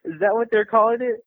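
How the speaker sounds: high, incredulous mock reply; retold bafflement. Artist retelling reaction to the devil question, high-pitched and baffled. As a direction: replay a puzzled reaction, pitched well up.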